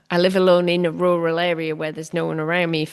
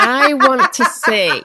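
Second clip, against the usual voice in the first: laughing; 7 semitones higher, 8 dB louder, slower than usual. Real, not acted. Laughing after being asked to pitch.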